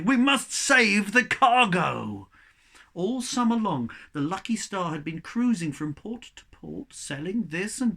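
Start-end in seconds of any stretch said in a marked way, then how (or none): 0.0-2.3 loud, slow, high command; urgent shout. A narrator's reading. Captain shouting orders as the ship sinks. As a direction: roar the order. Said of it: "he shouted".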